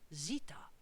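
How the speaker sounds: hushed whisper to the guests; whispered aside. Acted. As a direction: lean in and whisper it.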